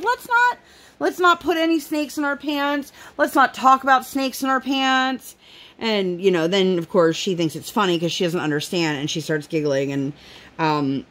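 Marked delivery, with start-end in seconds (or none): 0.0-5.4 patient mom. Retold or imitated. Mom correcting child, voiced high.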